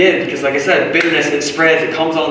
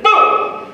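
unvoiced high boom; mock explosion. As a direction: burst it out like a crash. Acting out knocking domino.

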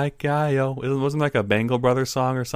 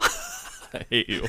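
laughing; 4 dB quieter, much slower than usual. Real, not acted. Mock offense after pun.

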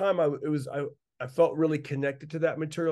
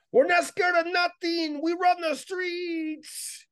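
very high, slow, put-on thug voice; squeaky tough-guy boast; tough gang member voice; much slower than usual. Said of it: "I would do the voices back and forth".

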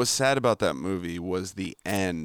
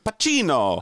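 Italian accent, higher and drawn out on Pacino; hammed-up Italian pronunciation of the name; mock Italian flourish; 6 semitones higher, 3 dB louder, slower than usual. Shown as a demonstration. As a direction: exclaim it like a proud mock Italian.

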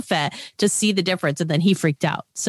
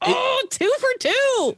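delighted gloat; slower than usual. Real, not acted. Gloating at being right.

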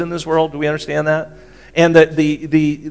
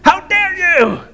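much higher, slow hammed-up indignant squeal; mock outrage; slower than usual. Preacher mocks easily offended churchgoers, hammed-up outrage. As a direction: parody someone going ballistic over a slight. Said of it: "we just go ballistic".

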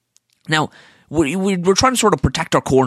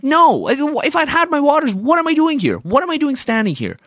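exasperated retort; 10 semitones higher than usual. Retold or imitated. Retorting to unhelpful waitress.

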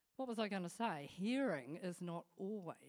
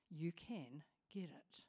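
soft unvoiced whisper; preacher whispering reassurance to listeners; 5 semitones lower, 10 dB quieter, slower than usual. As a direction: lean in and whisper the promise.

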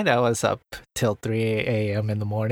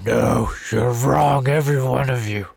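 menacing voice; 5 dB louder than usual. Pretend. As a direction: try to sound threatening. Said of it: "That's, that's not a very menacing voice".

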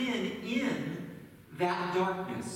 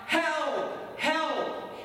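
high-pitched call from down a hole; mock cry for help; 8 semitones higher than usual. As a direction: call out for help without really yelling. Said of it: "he began to cry out".

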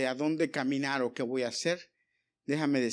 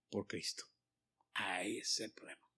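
preacher's whisper; 8 semitones lower, 11 dB quieter than usual. Real, not acted.

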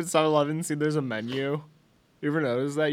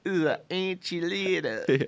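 high-pitched put-on Southern accent; 5 semitones higher than usual. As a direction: echo it in a mock Southern accent. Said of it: "I don't know why we do Southern accents".

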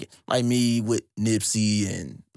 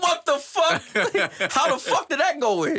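stunned disbelief; 14 semitones higher than usual. Retold or imitated. Retelling his reaction to the sample, high and laughing. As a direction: act floored by what you just heard.

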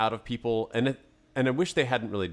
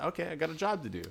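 quick, higher, unforceful acceptance; retold compliance; 6 semitones higher, 4 dB quieter, faster than usual. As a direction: imitate an employee giving in without fuss. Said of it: "But she said".

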